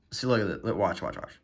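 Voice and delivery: normal talking voice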